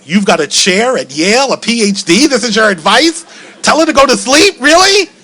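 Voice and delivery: incensed at the advice; building indignant rant ending high; furious disbelief. Retold or imitated. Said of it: "I'm furious".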